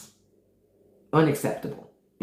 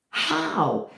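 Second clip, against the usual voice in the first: incredulous; 10 semitones higher than usual. Real, not acted. Disbelief at fake teeth.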